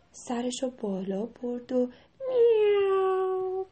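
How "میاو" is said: long, high drawn-out meow; cat meow. A narrator's reading. The cat lifts its head, high meow. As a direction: imitate a cat meowing slowly. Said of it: "یه صدا در آورد".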